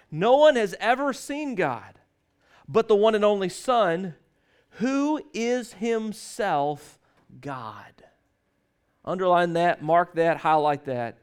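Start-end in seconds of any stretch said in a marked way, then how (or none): 0.1-8.0 preacher driving home that Jesus is God; dramatic emphasis. Shown as a demonstration. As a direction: pause before the last word and let it fall. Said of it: "quoting verse with emphasis".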